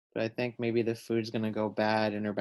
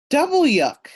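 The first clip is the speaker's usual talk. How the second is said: much higher, loud grossed-out squeal; extra disgusted; 13 semitones higher, 10 dB louder than usual. Acted. Sophia outdoing her friend, high loud disgusted squeal. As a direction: top the first yuck with a bigger squeal. Kid voiced in read-aloud.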